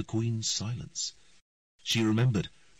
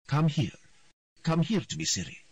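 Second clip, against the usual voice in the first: cold authoritative; 9 semitones higher than usual. A narrator's reading. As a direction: order the girl over coldly. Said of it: "in a cold and authoritative voice".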